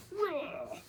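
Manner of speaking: very high, feeble little roar; tiny mouse roar. Acted. The little mouse attempting a roar, squeaky and weak. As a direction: try to roar but only squeak.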